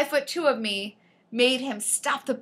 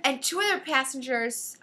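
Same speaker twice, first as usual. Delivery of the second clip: usual speaking voice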